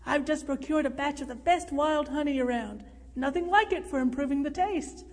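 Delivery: ordinary delivery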